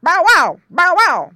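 loud high bow wow barks; barking dog. A narrator's reading. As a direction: bark as you chase. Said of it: "barked Don".

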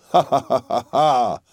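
staccato acted "ha ha" laugh; a hearty stage laugh in measured bursts; proprietor's laugh. A narrator's reading. Proprietor greeting his astonished guest, laughing. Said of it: "laughed the proprietor".

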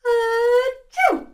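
big high sneeze; loud sneeze. A narrator's reading. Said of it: "sneezed Pally".

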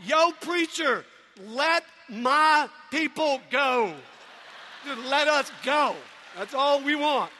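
slow, drawn-out comic proclamation; mock plea. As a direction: call out to the preacher, hammed up. Acted plea from the back pew.

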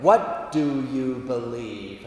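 slow, solemn intoned question; God. Acted. God questions the Dominican, slow and majestic. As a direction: let each word hang grandly. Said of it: "that's how he talks".

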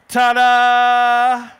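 loud held fanfare; showman ta-da. Acted. Kid daredevil presenting himself after the bath, loud fanfare. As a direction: trumpet the reveal.

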